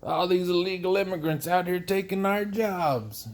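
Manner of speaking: complaining farmer. Acted. Farmers griping about illegal immigrants, mock complaining. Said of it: "We get that with the farmers out here".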